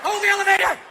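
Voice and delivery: high, loud panic; frantic cry. Retold or imitated. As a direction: gasp at it. Frantic exclamation.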